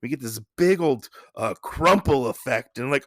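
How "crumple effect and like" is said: ordinary talk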